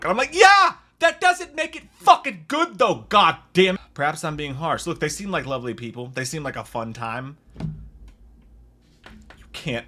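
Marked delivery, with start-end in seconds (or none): 0.3-3.8 loud, high outraged retort; exasperated yell. Retold or imitated. Reviewer arguing with kind online reviews, exasperated yell. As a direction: yell back in comic outrage, voice pitched way up.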